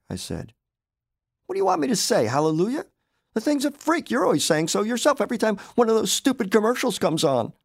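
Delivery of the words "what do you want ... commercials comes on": high, loud exasperated wife; a wife blowing up over the pet, voiced higher and louder. Wife scolding husband about the pet, high and loud.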